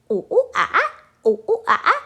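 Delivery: loud high ooh ooh ah ah; mock monkey calls, ooh ooh ah ah; monkey noises. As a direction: hoot like a playful monkey. Teacher imitates monkey sounds.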